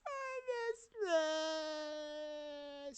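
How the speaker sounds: hammed-up high, drawn-out wail; mock crying. Faking grief over a dead best friend, loud mock crying. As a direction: wail in exaggerated fake grief, very high and stretched. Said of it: "starts crying".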